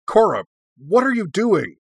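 high, loud burst of alarm; panicked outburst. A narrator's reading. Tenzin bursts out at Korra, panicked. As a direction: cry out in concern and panic. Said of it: "burst out in evident concern and panic".

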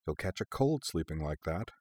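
soft, fond fussing; tender, quiet mock scolding; affectionate. A narrator's reading. Woman tucking a blanket round her sleeping partner, affectionately. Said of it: "she said affectionately".

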